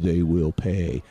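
low, drawn-out mock menace; creepy imitation. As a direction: imitate him creepily, dragging out each word low. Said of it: "leaned into the mic kind of creepily".

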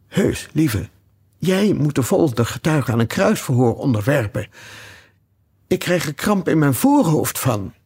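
usual speaking voice

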